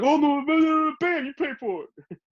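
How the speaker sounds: put-on high, whiny old-man protest; imitating Don Vito. Don Vito refusing to pay, imitated high and whiny. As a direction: plead it in a cracked high voice. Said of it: "Then he's like".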